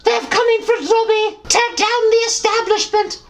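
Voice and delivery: high, rallying elf cry; a tiny elf leading a revolt; high house-elf battle cry; Dobby voice. Acted. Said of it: "Dobby has destroyed the government".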